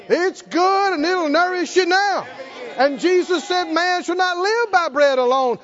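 high, forceful preacher's proclamation; full-force preaching. Real, not acted. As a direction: drive it home to the congregation.

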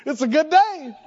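cheerful, upbeat hello with a smile; smiling greeting. Pretend. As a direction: breeze in smiling and happy. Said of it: "smiled and go".

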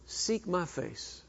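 quiet, slow, gentle command; the Lord answering gently; soft voice of God. Acted. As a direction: say it softly and slowly, like a calm answer. Voicing the Lord's answer.

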